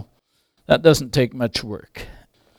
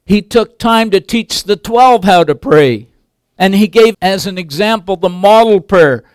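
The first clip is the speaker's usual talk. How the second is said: raised, loud pulpit voice building through the point; preaching at full force; 7 semitones higher, 6 dB louder than usual. Acted. Pastor teaching the church about prayer, preaching loud and high. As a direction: drive the lesson home to the congregation.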